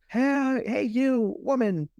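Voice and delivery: high-pitched mock cop call. Acted cop calling to Alice.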